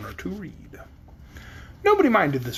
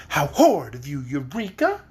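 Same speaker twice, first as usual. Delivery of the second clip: cried out; 2 semitones lower than usual. Acted. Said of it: "cried Dorothy".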